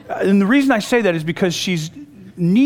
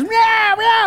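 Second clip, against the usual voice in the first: high mewing meow; imitating a scolding cat with high meows; 3 dB louder than usual.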